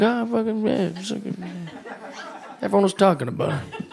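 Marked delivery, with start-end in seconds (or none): 0.0-1.7 mumbled, much softer, caught out; half-swallowed awkward agreement; sheepish mumble. Retold or imitated. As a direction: mutter the yes like you're embarrassed. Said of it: "You're like".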